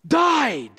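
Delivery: "died" cried out high after a long pause; preacher's climactic, falling shout on "died"; stress on died. Shown as a demonstration. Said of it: "Paul places the stress on the word died".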